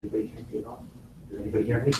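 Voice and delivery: very soft, unprojected question; tiny close-mic voice; barely audible. Shown as a demonstration. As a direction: speak too quietly for the room to hear. Shows inaudible talk of film actor.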